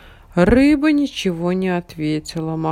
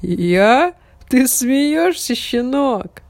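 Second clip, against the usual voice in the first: big dog voice; 6 semitones higher, 5 dB louder, much slower than usual. A narrator's reading.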